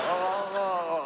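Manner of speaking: high strangled "oh" noises; mock choking as if puffing up; imitated frog-like swelling. Comic acting out the passenger's allergic reaction, swelling noises. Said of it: "blowing up like a frog".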